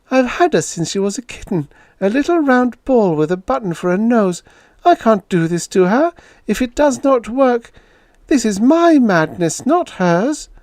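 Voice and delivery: woman begging to spare her cat; pleading Julia. Acted. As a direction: fight for the cat, near tears.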